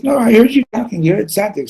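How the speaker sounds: high-pitched acted arguing; heated insistence.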